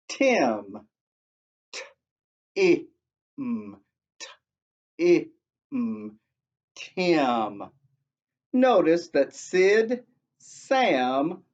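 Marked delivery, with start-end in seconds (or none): 0.1-7.6 "Tim", letters "T-I-M" named twice, then "Tim"; "T-I-M" spelled out, then the name; spelling out a word. Shown as a demonstration. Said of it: "And the last one".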